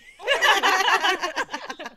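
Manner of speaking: high, very loud burst of overwhelmed laughter; loud fangirl squeal. Retold or imitated. Reaction to her favourite idol up close.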